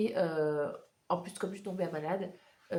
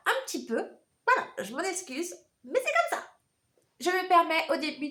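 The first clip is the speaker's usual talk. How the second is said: honking duck voice from a cold; squeaky nasal duck voice with a blocked nose; 9 semitones higher, 5 dB louder than usual. Real, not acted. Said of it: "cette petite voix de canard". (petite voix de canard)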